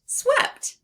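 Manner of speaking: Swept sung out high and loud; excited finish. Shown as a demonstration. Teacher celebrating the blended word with the class. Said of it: "Let's sound out swept together".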